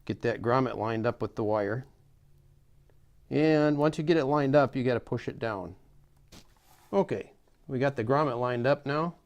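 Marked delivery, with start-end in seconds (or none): none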